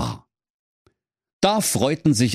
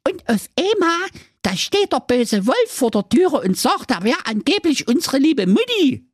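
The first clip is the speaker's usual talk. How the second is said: sehr hohe, piepsige Zicklein-Stimme, leicht japsend; hoch und quäkig wie ein junges Zicklein; kleinstes Geißlein. Acted. Geißlein erzählt weiter. (hohes Geißlein-Gepiepse nach der Drehtür)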